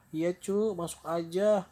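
high-pitched welcoming granny; fake grandmother. Wolf disguised as grandma invites grandchild in, fake granny voice. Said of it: "yang pura-pura jadi neneknya".

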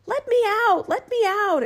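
high, loud cry to be released. A narrator's reading. Frog-like thing cries from the bottle, high loud plea. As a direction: call out urgently, high and loud. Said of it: "it cried again".

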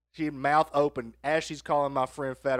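usual speaking voice

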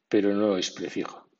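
usual speaking voice

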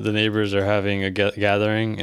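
ordinary talk

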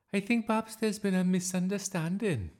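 high-pitched, loud schoolmistress; smooth things over in a high voice; placating teacher. A narrator's reading. Teacher placating an angry colleague.

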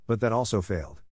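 plain reading voice

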